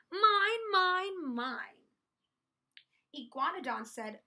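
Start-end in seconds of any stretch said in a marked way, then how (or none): 0.1-1.9 high, very loud greedy roar, dropping at the end. A narrator's reading. Minosaur to all the dinosaurs, roaring. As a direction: roar possessively at everyone. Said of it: "he roared to one and all".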